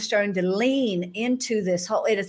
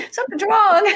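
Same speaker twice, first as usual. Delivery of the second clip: laughing through the words, high and bright; can't hold back a laugh as she says it; 13 semitones higher, 6 dB louder than usual. Real, not acted. Laughing as she recalls fearing something was wrong. Laughing while retelling her panic.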